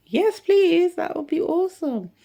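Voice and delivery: very high, loud delighted acceptance; eager yes. Retold or imitated. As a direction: jump at the offer. Eager reply to teacher.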